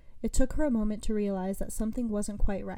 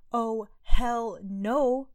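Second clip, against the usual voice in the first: yelling; 4 semitones higher, 6 dB louder, much slower than usual. A narrator's reading. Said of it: "Arya continued her yelling".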